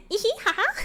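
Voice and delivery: high, put-on giggle; mock cutesy giggle. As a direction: giggle like a sweet pop ingénue. Giggling in character.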